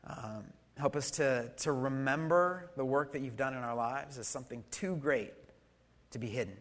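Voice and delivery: soft, hushed prayer to God; quiet prayer. Real, not acted. Pastor praying over the congregation, hushed and earnest. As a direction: pray gently over the congregation.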